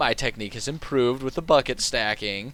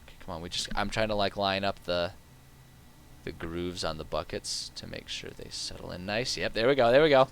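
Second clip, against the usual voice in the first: ordinary delivery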